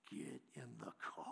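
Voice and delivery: very low, hushed command; an upset man's order, held right down; quiet stern order. Retold or imitated. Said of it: "The guy turned around like this, and he said".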